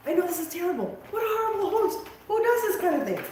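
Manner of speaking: high-pitched mock-outraged critic; mock complaint. Mock-voicing complaints about herself as host.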